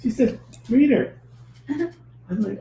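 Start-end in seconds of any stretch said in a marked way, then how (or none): 0.7-1.1 high, bright announcement; high announcing call. Retold or imitated. Hostess announces her role.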